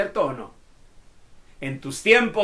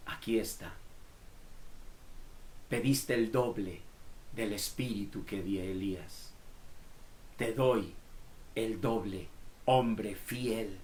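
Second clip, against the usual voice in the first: gentle answer; 6 semitones lower, 13 dB quieter than usual. Acted. God answering Eliseo.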